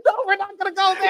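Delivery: fast, high-pitched protest; hurried refusal. Real, not acted. Co-host cutting off talk of Jericho's wife, high hurried refusal. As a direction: shut it down in a high rush.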